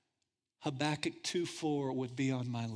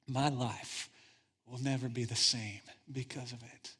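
quiet testimony; 5 semitones lower, 5 dB quieter than usual. Real, not acted. Personal testimony, softened.

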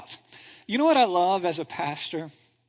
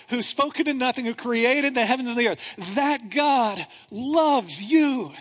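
usual speaking voice

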